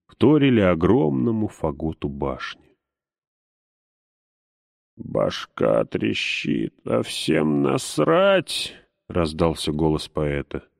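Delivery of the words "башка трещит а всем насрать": hungover, groaning and dragged out; slow pained poet with a splitting head; headache. Acted. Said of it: "Держась за голову".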